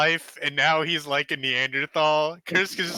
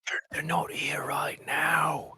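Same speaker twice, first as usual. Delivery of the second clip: whispering. Acted. Character whispers at night.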